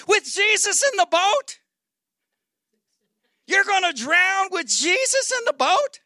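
high, rising disbelief; incredulous. Acted. Preacher challenging the disciples' fear of drowning, incredulous. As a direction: ask it as if utterly preposterous.

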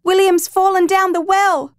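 high, loud desperate cry; panicked shout. Acted. Mother shouting that her son fell, panicked cry. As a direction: scream the news in terror. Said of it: "Janelle shouted".

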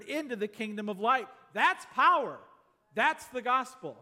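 rising, full-force sermon delivery; preaching. Acted. Preacher to congregation about the gospel's power, full-force preaching.

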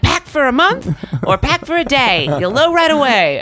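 hoog en luid, de theatrale oude agent nadoen; a hammed-up old showbiz agent, loud and high; dramatic agent. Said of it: "They spoke in rhymes?".

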